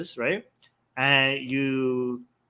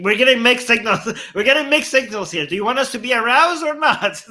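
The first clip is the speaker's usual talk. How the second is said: high, loud, incredulous protest; hammed-up exasperated complaint, pitch jumping up; mock exasperation; 8 semitones higher, 8 dB louder than usual. Confronting a woman over mixed signals, mock exasperation.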